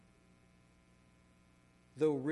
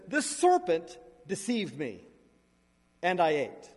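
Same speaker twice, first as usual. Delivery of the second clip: defensive Eve; 2 semitones higher, 9 dB louder than usual. Acted. Eve blaming the serpent.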